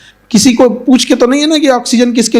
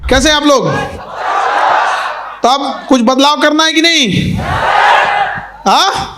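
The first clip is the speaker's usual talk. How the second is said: raised, higher-pitched rallying call; calling to a crowd; 4 semitones higher than usual. Real, not acted. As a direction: fire up the audience for a response.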